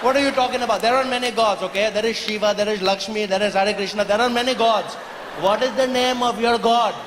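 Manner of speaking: lightly put-on Indian accent. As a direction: argue patiently in an Indian accent. Indian farmer arguing.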